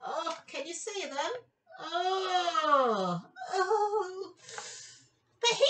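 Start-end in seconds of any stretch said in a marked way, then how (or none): none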